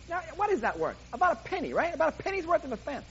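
ordinary talk, nothing marked

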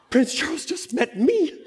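high-pitched mock fan squeal; starstruck excitement. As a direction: act out breathless, giddy awe at meeting royalty. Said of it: "I'm like this".

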